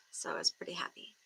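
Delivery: breathless whispered aside. Real, not acted. Streamer confiding to her chat in a whisper. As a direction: drop to a quick whisper.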